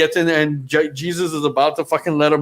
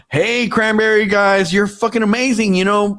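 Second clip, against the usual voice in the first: high mock gushing; 7 semitones higher than usual. Guy addressing Cranberries sarcastically.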